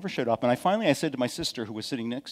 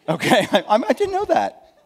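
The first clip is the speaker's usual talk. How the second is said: laughing; 9 semitones higher, 7 dB louder than usual. Real, not acted. Laughs at own ignorance.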